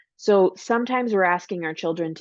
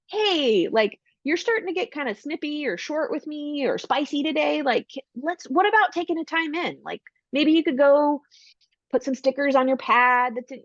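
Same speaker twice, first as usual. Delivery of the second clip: higher, light coaxing; gentle parent; 8 semitones higher than usual. Shown as a demonstration. Parent suggesting a time in to a snippy child, higher and gentle. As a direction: invite them kindly, no scolding. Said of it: "This is not a punishment".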